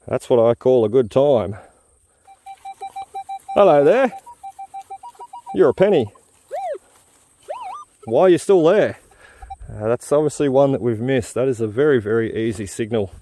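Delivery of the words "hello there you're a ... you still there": high, bright sing-song; chirpy hello to a coin; playful greeting. Real, not acted. Greeting a found penny.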